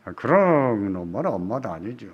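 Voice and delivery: ordinary delivery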